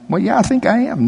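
whiny voice. Retold or imitated. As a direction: answer like a meek whiner. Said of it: "that whiny guy".

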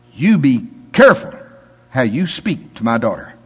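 firm fatherly warning, lightly voiced; stern God voice. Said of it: "I heard it in this tone of voice".

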